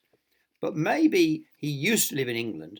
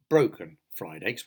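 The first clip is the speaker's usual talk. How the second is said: ordinary delivery, nothing marked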